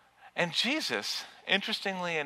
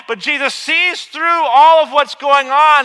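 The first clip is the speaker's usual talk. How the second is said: loud, rising, impassioned preaching; full-force preaching; 12 semitones higher, 15 dB louder than usual. Acted. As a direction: drive it home to the congregation. Preaching loudly.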